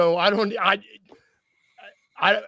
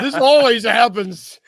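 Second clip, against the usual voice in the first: slow, loud, higher complaint; mock lament; 5 semitones higher, 9 dB louder than usual. To his cohost about being let go, a slow mock lament. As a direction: play the put-upon victim, dragging it out.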